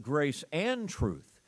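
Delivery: AND leaned on, slowly, between grace and truth; stress on AND. Shown as a demonstration. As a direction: make AND the strong word of the phrase. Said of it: "Notice my emphasis on that word".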